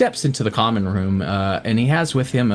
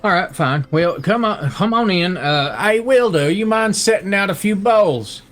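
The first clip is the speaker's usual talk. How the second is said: rustic voice rising higher as he gives in; relenting farmer Pa; 7 semitones higher than usual. Acted. Pa letting strangers in for dinner, grudgingly hospitable. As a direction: grumble agreement, then call out to the wife. Pa relents, calls his wife.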